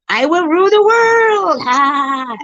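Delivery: high, loud villain proclamation; megalomaniac rant. Pretend. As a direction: declare it like a cartoon villain.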